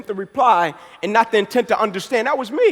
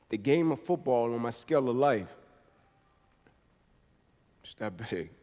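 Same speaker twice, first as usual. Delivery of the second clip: ordinary delivery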